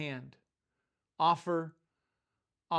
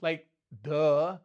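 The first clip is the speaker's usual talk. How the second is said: louder, sarcastic "duh"; mock scorn; 5 dB louder than usual. Real, not acted. Preacher scoffing at the clueless disciples, sarcastic.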